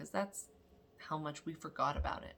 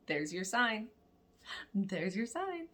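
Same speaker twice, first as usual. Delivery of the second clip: louder, higher gloating repeat; gleeful; 5 semitones higher, 7 dB louder, slower than usual. Retold or imitated. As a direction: rub it in with glee.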